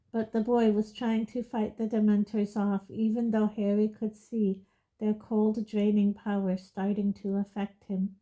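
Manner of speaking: ordinary delivery, nothing marked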